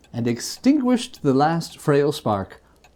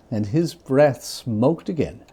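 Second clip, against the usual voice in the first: ordinary delivery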